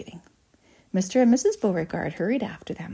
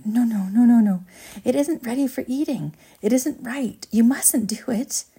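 urgent, raised-pitch pleading; agitated protest; 5 semitones higher, 4 dB louder than usual. A narrator's reading. Wonka stops Violet eating untested gum, agitated protest. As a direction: protest in a rising fluster.

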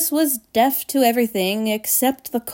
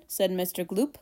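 ordinary delivery, nothing marked

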